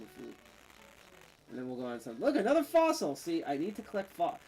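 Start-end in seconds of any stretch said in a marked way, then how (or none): 2.2-3.2 excited. Real, not acted. Spots a fossil in game.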